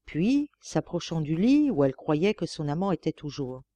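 usual speaking voice

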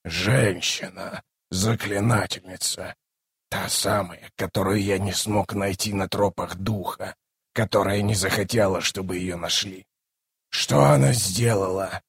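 low, quiet sorcerer thinking aloud; brooding bone caster. Acted. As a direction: speak softly and low, piecing it together.

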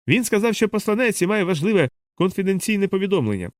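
contained storybook yell; mild shout. A narrator's reading. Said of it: "кричав чоловік".

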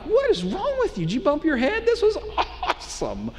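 high-pitched, laughing mock outrage; hammed-up disbelief. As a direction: stretch it out in exaggerated, incredulous enthusiasm. Acted disbelieving retort to a companion.

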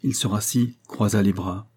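plain reading voice

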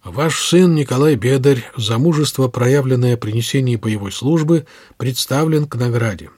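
formal, measured official proclamation; solemn. A narrator's reading. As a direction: proclaim an award with deliberate solemnity. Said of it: "стараясь придать голосу торжественность".

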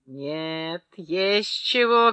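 drawn-out, restrained call-out; mild narrated cry. A narrator's reading. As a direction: read a character's shout with a held-back voice. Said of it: "крикнул Клычков".

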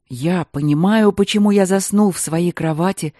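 anguished outburst. Acted. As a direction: break out loud in self-reproach. Baron's horror at attacking her.

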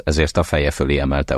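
ordinary delivery

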